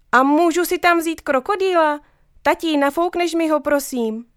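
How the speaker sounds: put-on a small girl begging daddy sweetly; little girl pleading. A narrator's reading.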